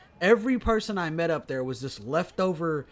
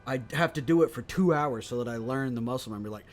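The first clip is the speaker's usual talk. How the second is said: usual speaking voice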